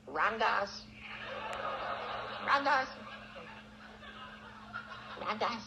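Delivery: high, carrying call across a courtyard. Retold or imitated. Maharaji calls Ram Dass from across the courtyard, high carrying call.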